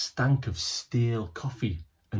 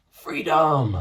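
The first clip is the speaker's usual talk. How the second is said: loud, proud announcement; triumphant cry; 3 semitones higher, 4 dB louder than usual. A narrator's reading. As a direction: proclaim it like a victory. Chapter title, triumphant release.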